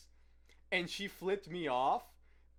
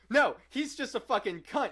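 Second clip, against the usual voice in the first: louder and much higher, blurting; retelling a coworker's loud retort; hammed-up loud retort; mock outburst; 10 semitones higher, 7 dB louder than usual. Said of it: "he goes".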